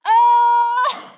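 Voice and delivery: very high, loud squeal of surprise; delighted surprise. Retold or imitated. Cast member asked into a family photo, high loud squeal. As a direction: squeal it, caught off guard and thrilled.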